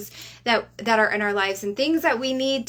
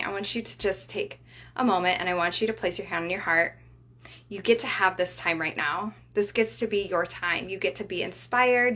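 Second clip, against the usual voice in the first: guided breathing. Acted. Leads a breathing exercise.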